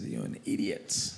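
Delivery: unvoiced hissed scold; whispered insult. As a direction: mutter the insult. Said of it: "He said".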